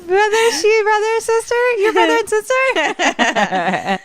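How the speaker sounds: high squealing disbelief through laughter; mock astonishment. Everyone reacts to the sibling reveal, squealing and laughing. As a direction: stammer in confused shock, cracking up. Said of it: "they're all like".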